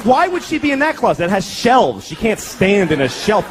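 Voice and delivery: ordinary talk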